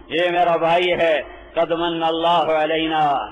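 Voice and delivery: ordinary talk, nothing marked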